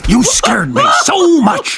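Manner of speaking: excited. Acted. Monster startled by scare.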